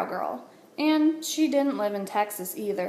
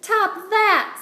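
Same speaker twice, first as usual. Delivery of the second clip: high, louder challenge; triumphant taunt; 10 semitones higher, 5 dB louder than usual. A narrator's reading. Alice after jumping the teeter-totter, high triumphant taunt. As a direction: crow it at the rival, pitched up. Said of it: "said Alice".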